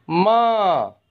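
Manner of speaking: माँ said high, vowel through the nose; clear demo. Shown as a demonstration. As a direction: model माँ for children to repeat. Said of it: "इन शब्दों का उच्चारण करें".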